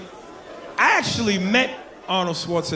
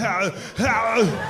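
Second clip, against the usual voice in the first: higher, drawn-out "ow" groan; strained mock yell; 4 semitones higher than usual. Shown as a demonstration. As soon as you get down there, strained yelling groan. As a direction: groan like an action hero in agony. The same noise in the bathroom.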